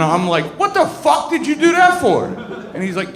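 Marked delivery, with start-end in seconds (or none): 0.6-2.2 incredulous outburst. Retold or imitated. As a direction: blurt it out, shocked and laughing. Cousin shoots at a house.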